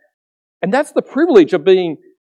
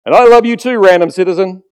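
heroic superhero reply; 4 semitones higher, 7 dB louder than usual. Retold or imitated. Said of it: "looks at the person and goes".

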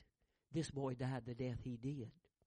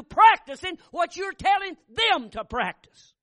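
higher and louder exhortation, booming at the end; fervent preacher's exhortation; preaching at full force; 8 semitones higher, 14 dB louder than usual. Acted. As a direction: call the parents out from the pulpit.

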